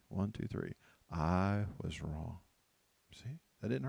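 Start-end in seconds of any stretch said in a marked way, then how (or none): 1.1-2.4 "I was wrong" very slow and low; slow, stretched recitation of "I was wrong"; drawn-out confession. Shown as a demonstration. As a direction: lead a group through "I was wrong", word by word. Leads congregation saying it on count.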